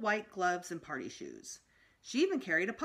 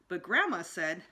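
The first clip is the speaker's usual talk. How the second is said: ordinary delivery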